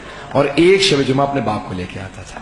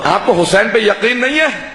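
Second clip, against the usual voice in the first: scolding rebuke, voice raised; indignant shout; 8 semitones higher than usual. Acted. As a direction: shout the question at the doubters. Rebuking doubting scholars.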